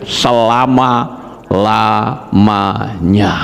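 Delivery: very slow, low, syllable by syllable; drawn-out correction. Acted. Preacher corrects it to eternity, very slow and low. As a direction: stretch out every syllable, low.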